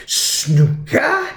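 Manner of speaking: high, incredulous mock outburst; outraged head teacher. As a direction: imitate an exploding headmaster, pitch shooting up. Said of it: "thought the head was gonna explode".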